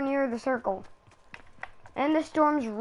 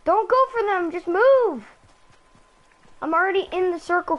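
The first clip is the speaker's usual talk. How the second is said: urgent, high and loud; panicking gamer; 5 semitones higher, 4 dB louder than usual. Real, not acted. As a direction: push him to move right now. Storm closing, urging teammate on.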